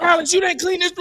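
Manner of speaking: stern father. Retold or imitated. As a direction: call out the messy room like a dad. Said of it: "I said".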